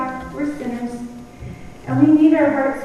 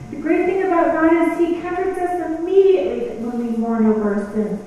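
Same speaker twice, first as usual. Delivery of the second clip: normal talking voice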